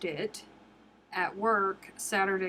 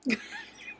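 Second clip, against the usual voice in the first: real giggle, high and breathy; high squeaky laugh. Real, not acted. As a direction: laugh at her own blunder. Laughs at her own mistake.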